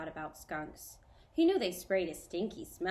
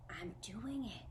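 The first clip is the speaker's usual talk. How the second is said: softer, hushed amazement; whispered; 4 semitones higher, 6 dB quieter, slower than usual. A narrator's reading. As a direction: say it under your breath, amazed. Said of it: "Bat whispered".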